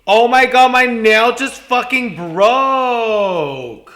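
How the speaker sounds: loud, high-pitched wail over a broken nail; shocked wail. Real, not acted. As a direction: scream it out, dragging the last word. Nail has just snapped off.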